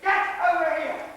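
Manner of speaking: high, forceful yell; shouted order. Acted. Gunman ordering a man across, angry shout. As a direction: yell the command at full voice.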